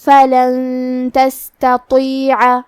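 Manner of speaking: فلن with a nasal ghunna on the نون before تستطيع; slow recited demo. Shown as a demonstration. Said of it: "غنہ کے ساتھ ادا کریں گے".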